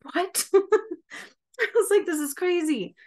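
high, slow, laughing disbelief; shocked disbelief. Retold or imitated. [name] reacting in disbelief to being called a healer, high and laughing. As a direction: gasp out that it's crazy. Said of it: "And I'm like".

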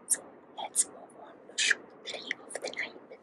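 slow hushed whisper; slow late-night whisper. Real, not acted. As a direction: whisper it so the brother sleeps on. Everyone asleep, late shoot.

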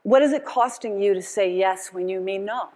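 normal talking voice

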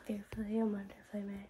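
quick hushed whisper. Real, not acted. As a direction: breathe it out softly.

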